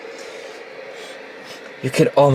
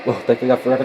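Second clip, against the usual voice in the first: usual speaking voice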